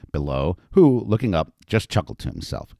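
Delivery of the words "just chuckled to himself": usual speaking voice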